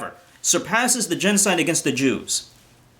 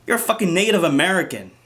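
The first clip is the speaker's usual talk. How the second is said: usual speaking voice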